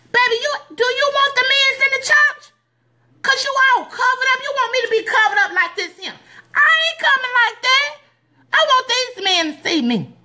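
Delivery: high-pitched, sassy talking back; defiant sassy reply. Retold or imitated. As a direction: talk back to the church mother with attitude. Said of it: "here she go again".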